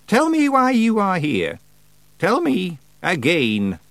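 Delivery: slow, high, imperious witch voice; commanding witch. Acted. Witch demands truth from Feegles, commanding. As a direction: stretch out the command.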